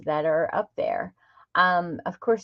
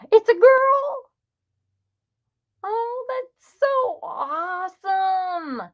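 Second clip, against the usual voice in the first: high, slow overjoyed cry; delighted squeal; 13 semitones higher, slower than usual. Real, not acted. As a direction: gush with joy at the news. Hears the baby is a girl.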